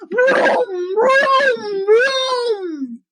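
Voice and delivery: mock rumbling vroom; engine noise. Narrator imitating the giant father approaching, rumbling vroom. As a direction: make the giant's footsteps roar like an engine. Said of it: "David's father sounded like this".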